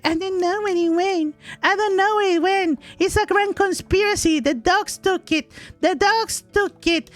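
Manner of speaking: high, whiny mock panic; mocking husband's whine. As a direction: imitate a husband blaming the dogs. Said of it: "and then you're like".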